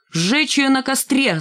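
high, drawn-out mock yell. A narrator's reading. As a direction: call for the punishment like a crowd heckler. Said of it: "заорал Рыжий". (заорал высоким голосом)

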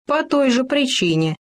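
lightly superior tone; condescending. A narrator's reading. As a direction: answer from above with a touch of disdain. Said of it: "свысока ответил дятел".

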